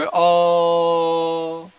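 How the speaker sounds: अ drawn out long and held; prolonged vowel. Shown as a demonstration. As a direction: stretch अ for three beats. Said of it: "तीन की वजह से आप उसको लंबा बोलोगे".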